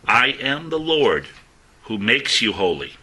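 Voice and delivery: weighty pulpit reading; solemn scripture reading. Acted. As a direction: proclaim it like God speaking. Reads scripture with force.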